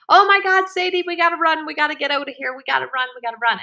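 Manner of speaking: high, loud cry of alarm, easing as it goes; urgent run-for-it shout, tapering off; panicked yelling. Retold or imitated. Retold panicked flight from bear.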